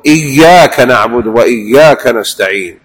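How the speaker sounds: إياك نعبد وإياك نستعين slowly, the ya in إياك held doubled; slow and careful, press the double ya. Shown as a demonstration. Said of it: "وقل". (إياك بتشديد الياء ببطء)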